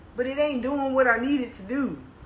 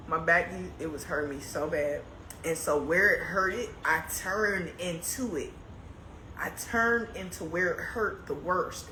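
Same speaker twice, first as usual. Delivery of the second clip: quiet; 6 semitones lower, 6 dB quieter than usual. Real, not acted. Low tired story of back pain.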